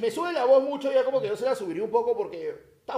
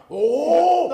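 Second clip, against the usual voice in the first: very loud, higher "oh" holler; kitchen reply shout; 4 semitones higher, 11 dB louder than usual. Retold or imitated. Cook answering from the kitchen, loud mock holler. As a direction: bellow one long answering "oh". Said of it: "de la cocina escucha".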